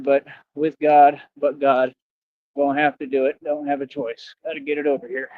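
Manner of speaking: ordinary talk, nothing marked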